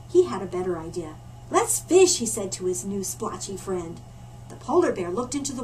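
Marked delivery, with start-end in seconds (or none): 1.5-2.2 eager suggestion. Acted. Polar bear proposes fishing.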